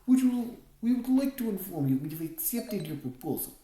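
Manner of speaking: put-on formal Russian-accented phrasing. A narrator's reading. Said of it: "with a thick Russian accent".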